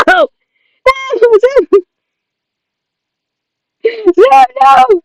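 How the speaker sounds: high, crying scream of his name; mock anguished scream. Dib screaming through his window at Zim, high crying yell. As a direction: shriek it like he's watching his friend fall. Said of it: "like, s-, screaming".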